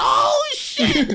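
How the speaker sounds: very high laughing exclamation; laughing high-pitched oh shit; delighted shriek. Retold or imitated. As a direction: squeal with delight at the answer. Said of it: "I said".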